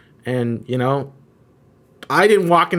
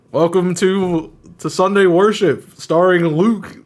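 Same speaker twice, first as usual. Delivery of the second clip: slow, higher-pitched marquee announcement; mock showbiz billing, stretched out and raised in pitch; showy announcer; 5 semitones higher, slower than usual. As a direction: announce the star of the show, drawn out. Imagined glitzy church marquee announcement.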